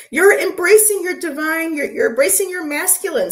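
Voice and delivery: very high, giddy voice; playful teasing. Real, not acted. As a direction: tease in a high, delighted squeal. Playful teasing after laughing.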